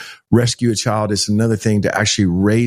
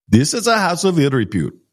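higher, clipped imitation of the Indian driver's accent; harsh Indian accent; 7 semitones higher than usual. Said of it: "and his accent was so harsh".

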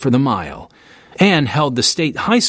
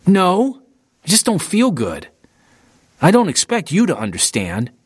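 irritated, higher-pitched protest; a touch of rising temper; temper flaring; 5 semitones higher than usual. A narrator's reading. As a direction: snap back in mild annoyance. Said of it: "Louis's temper flared".

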